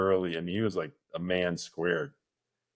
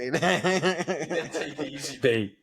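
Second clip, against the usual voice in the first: slow, laughing repeat, a little higher; laughing echo; 6 semitones higher, much slower than usual. Real, not acted. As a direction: savor the line while chuckling. Echoes friend's line, laughing.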